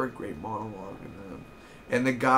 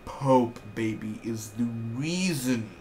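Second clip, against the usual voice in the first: slow, drawn-out praise; hyped rhyme; slower than usual. Real, not acted. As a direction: savour every word. Hyping his favourite character.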